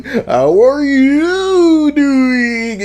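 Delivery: slow, high, stretched "How are you doing?"; goofy repeated phrase. Retold or imitated. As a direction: drag the phrase out like a dumb meme. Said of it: "if we were just going".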